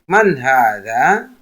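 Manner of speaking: Man hadza asked at a slow pace; deliberate demo question; slow model question. Shown as a demonstration. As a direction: spell out the question for learners. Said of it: "Kita memakai kata tanya man".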